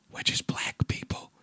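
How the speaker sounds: whispered old-man Biden impression; hushed Biden-style whisper; Joe Biden voice. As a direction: whisper it like a frail old president. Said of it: "in my Joe Biden voice".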